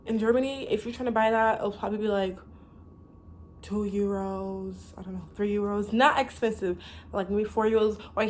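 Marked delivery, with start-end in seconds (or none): none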